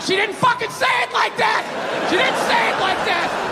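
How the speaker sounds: mock-indignant shout, pitched high; exasperated protest. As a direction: yell it at the courtroom in disbelief. Defendant protesting in court.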